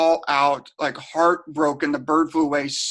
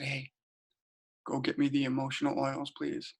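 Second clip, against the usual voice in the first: much softer, near-whispered request; hushed word to the younger sister; quiet aside; 11 dB quieter than usual. Retold or imitated. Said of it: "So I quietly leaned over to".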